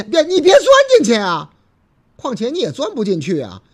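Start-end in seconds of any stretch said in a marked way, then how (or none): 0.0-1.5 loud, high cry of no; 大声阻止. Acted. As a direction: shout to stop her climbing in. 司机大声阻止. (司机高声喊别钻进去)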